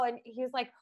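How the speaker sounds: usual speaking voice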